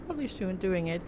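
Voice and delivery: ordinary talk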